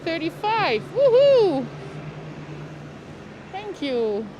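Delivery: usual speaking voice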